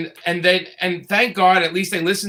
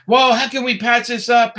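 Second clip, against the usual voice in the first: pleading producer; 6 semitones higher than usual. Retold or imitated.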